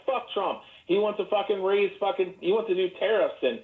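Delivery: lightly voiced AI parroting anti-Trump complaints; mock chatbot rant. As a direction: reel off complaints as the pandering bot. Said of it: "And it'd be like".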